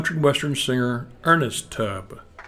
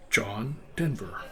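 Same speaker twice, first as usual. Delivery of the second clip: low-key, soft, slow reply; understated caller; 3 semitones lower, 13 dB quieter, slower than usual. Retold or imitated. Famous caller introduces himself to the mother, soft understated answer. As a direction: give the name quietly and slowly. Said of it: "he says".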